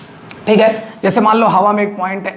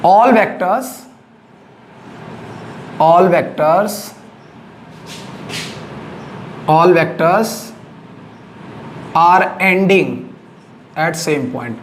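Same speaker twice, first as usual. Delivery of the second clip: repeated, drawn-out words with long pauses; teacher dictating word by word; slow dictation; 3 semitones lower, much slower than usual. Shown as a demonstration. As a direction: dictate the definition slowly. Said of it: "कोटर्मिनस vector क्या है?".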